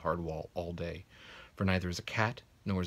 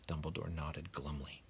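ordinary delivery

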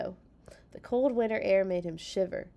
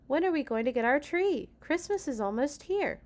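lightly voiced child, higher pitch; child's voice; 7 semitones higher than usual. A narrator's reading. Child asking papa about the tree, lightly voiced child. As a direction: ask like an eager child.

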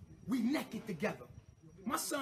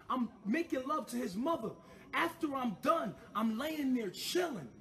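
ordinary delivery

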